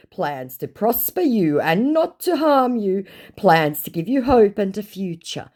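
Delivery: high, swooping hammed-up scripture reading; mock a scammer preaching a blessing. Reads scammer quoting scripture.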